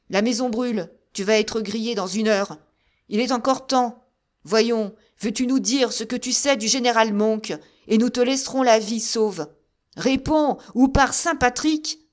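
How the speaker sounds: bully him into answering; threatening shout. A narrator's reading. Officer shouting threats at the besieged man.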